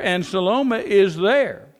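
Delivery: slow it down and let the refrain ring; slow emphatic refrain. Acted. Preacher drives home the refrain to congregation, slow and emphatic. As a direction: stretch out Salome is there, landing hard.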